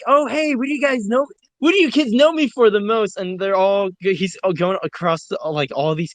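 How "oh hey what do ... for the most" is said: high, cheery mascot voice, pitched way up; Ronald McDonald voice. Retold or imitated. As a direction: play the upbeat clown quizzing a room of kids. Ronald McDonald host asks kids.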